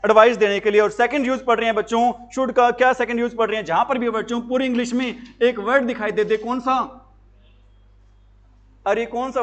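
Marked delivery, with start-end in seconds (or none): none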